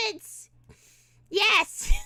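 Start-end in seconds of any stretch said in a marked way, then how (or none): none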